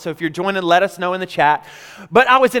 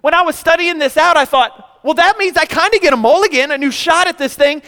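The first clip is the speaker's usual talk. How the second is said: full-force preaching; 6 semitones higher than usual. Acted. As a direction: preach it to the congregation with rising fire. Preaching about daily mulligans.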